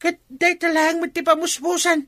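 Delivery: put-on very high, insistent old man's voice; insistent old suitor. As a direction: press the case in a high-pitched squawk. Old man presses refusing woman.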